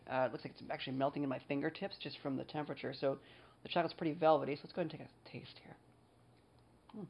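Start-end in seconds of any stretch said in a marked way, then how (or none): 4.5-7.0 whispering. Real, not acted. About to taste the chocolate.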